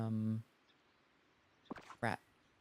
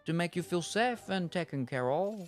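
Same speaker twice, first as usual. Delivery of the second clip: lightly voiced rat chef, pitched up; chef rat reminiscing; 9 semitones higher than usual. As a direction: share fond food memories as the chef. Voices the rat chef's dialogue.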